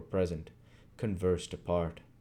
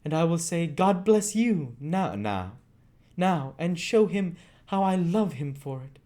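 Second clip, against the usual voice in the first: put-on high boyish voice, earnest and tender; a boy promising comfort; tender Oliver; 10 semitones higher, 6 dB louder than usual. Oliver telling Rose she'll cry, high and tender.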